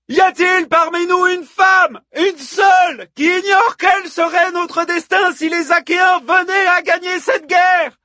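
high, loud, hammered-out rallying questions; bellowing. A narrator's reading. Hippodamie asks the women who has lost kin, bellowing. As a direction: shout each item to a roused crowd. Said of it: "beugla Hippodamie au sein du vacarme".

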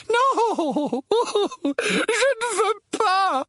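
high, slow, wailing protest; terrified refusal. A narrator's reading. Aunt Petunia refusing to open the Howler, terrified refusal. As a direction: wail it out high in dread.

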